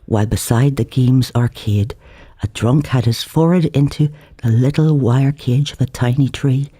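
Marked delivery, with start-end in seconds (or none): none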